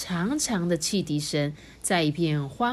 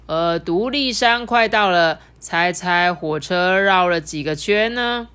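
louder, slow announcer read; conductor announcement; 7 dB louder, slower than usual. Acted. Conductor broadcasting a riddle to passengers, louder and slow. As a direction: announce it to the whole train. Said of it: "列车长就广播了".

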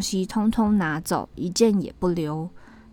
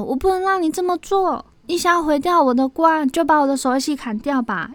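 high, raised defiant cry; 7 semitones higher, 5 dB louder than usual. A narrator's reading. Sister shielding her pumpkins from her brother, crying out. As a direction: refuse desperately at raised pitch. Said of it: "叫喊地说".

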